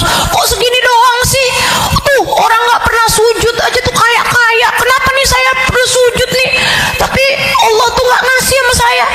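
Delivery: whiny complainer. Retold or imitated. Ungrateful person grumbling at God's gifts, high whining complaint. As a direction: whine like someone never satisfied.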